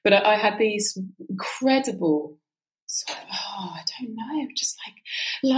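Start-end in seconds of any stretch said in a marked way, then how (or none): 2.9-5.5 breathy searching whisper. Real, not acted. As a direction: hush it while groping for words.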